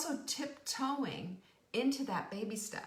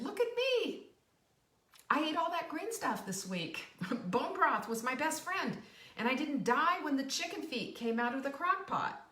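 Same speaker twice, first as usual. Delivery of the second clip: much higher, bragging "look at me"; proud boast; 6 semitones higher, 4 dB louder than usual. Pretend. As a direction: show off the win proudly. Proud boast after succeeding.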